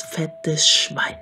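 plain reading voice

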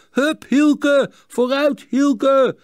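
high, loud cheers urging on a racer; cheering shouts. A narrator's reading. Friends urge Hielke on in the race, cheering shouts. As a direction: cheer them on from the sidelines. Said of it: "terwijl zijn vrienden riepen".

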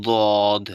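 দদ said loud and drawn out from the tongue's side edge; Arabic letter dad. Shown as a demonstration. Teacher modelling দদ for students, loud and deliberate. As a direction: model দদ clearly for learners, tongue's side against the upper molars. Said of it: "এবার হচ্ছে দদ".

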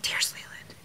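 urgent whisper. Real, not acted. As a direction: hiss a cue in a whisper. Whispered stage direction.

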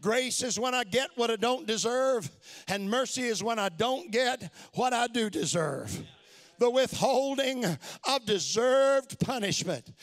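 usual speaking voice

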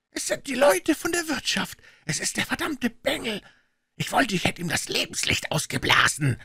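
high, strained voice; angry calling out. A narrator's reading. Puh blaming the inn's boy, angry cry. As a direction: rail bitterly at the culprit. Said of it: "rief der blinde Puh".

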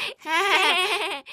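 mocking laugh.

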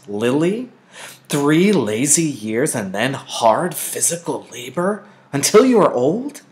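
loud, rising stern mother's warning; stern lecture. A narrator's reading. Mother sternly lectures Lily about birth mothers. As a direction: press the point hard on the child.

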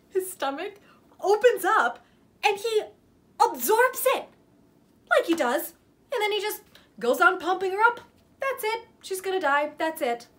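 high, loud, urgent horror telling; worked-up retelling. Acted. Retelling the monster absorbing the saw, high and excited. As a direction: tell it high and breathless with excitement.

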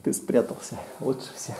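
unvoiced sneaky whisper. Волк ищет спрятавшегося ребёнка. (шёпотом)

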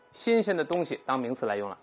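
ordinary talk

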